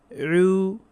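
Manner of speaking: রু as a long oo from the throat, lips rounded. Shown as a demonstration. Teacher correcting the lip shape, rounded রু. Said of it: "ঠোঁট গোল করে ফেলতে হবে".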